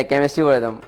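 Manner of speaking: ordinary delivery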